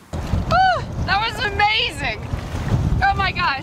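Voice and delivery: breathless high delight; thrilled after surfacing; excited. Real, not acted. Surfacing after seal dive, thrilled.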